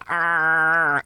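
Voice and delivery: high, sudden horrified gasp; zombie gasp scream. Retold or imitated. Describing the I Am Legend zombie, short imitated shriek. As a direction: mimic the zombie jolting upright. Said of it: "this horrifying".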